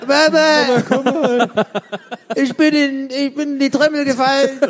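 put-on high, whiny child calling out for mum; yelling across the room. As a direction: call out like a stuck little kid. Acted child stuck in chip drum.

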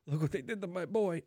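a hint of an aging Mafia don lamenting his son; quick nod to a Godfather line, half-hearted; Godfather impression. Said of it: "if you've seen The Godfather".

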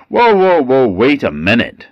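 high, loud whoa pulling him up short; startled objection. Acted. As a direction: stop him mid-sentence. Tatterhood stops the king.

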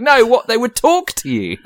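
high-pitched mock whine; high squeaky voice. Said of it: "It's like".

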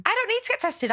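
high, defensive protest. Retold or imitated. Brushing off mum's suggestion to get tested, high and defensive. Arguing with mum.

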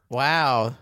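usual speaking voice

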